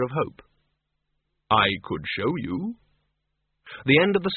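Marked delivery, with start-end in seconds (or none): 1.5-2.8 measured, heavy disapproval; Death's deep voice. Acted.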